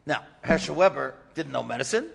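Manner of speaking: normal talking voice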